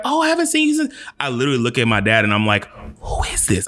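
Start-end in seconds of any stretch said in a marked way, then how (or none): 0.0-0.9 put-on very high gushing older lady voice; high imitated older lady. Stranger at party gushing to him, very high mock voice. 3.0-3.7 silent, unvoiced mouthing; mouthed whisper. Asking his dad silently at the party, mouthed whisper. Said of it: "I'm mouthing".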